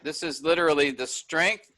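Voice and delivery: usual speaking voice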